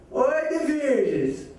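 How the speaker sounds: high, slow mocking call from the window; shrill gossip's call. Old gossip calling from her window, high and drawn out. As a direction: drag it out like a nosy old lady calling. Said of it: "gritou a outra".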